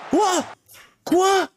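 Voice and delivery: sharp, high shouted "Quoi?" in disbelief; shocked shout. Real, not acted. Streamer stunned by the penalty call, high shocked shout.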